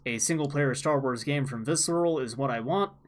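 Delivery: usual speaking voice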